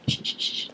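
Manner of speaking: soft, unvoiced shushing sounds; quick hushed rubbing sound effect; imitated back-rubbing noise. Vlogger showing how he rubbed her back, soft shushing. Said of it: "like that".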